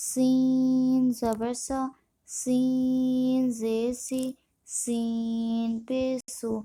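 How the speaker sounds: سین held long on its ee, then the rest short; slow spelling drill, long-drawn سین; elongated madd. Shown as a demonstration. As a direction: stretch the vowel in سین for five counts. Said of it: "مد ہوگا اسے ہم پانچ الف کھینچیں گے".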